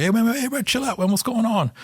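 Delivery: calling out. Retold or imitated. Officer to two arguing young men, retold calling out.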